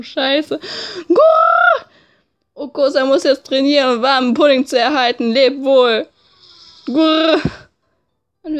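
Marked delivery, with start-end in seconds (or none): 1.1-1.9 very high loud coo; shrieked pigeon coo. Acted. Pigeon battle cry. 5.3-7.4 pigeon voice. Acted. Pigeon says farewell.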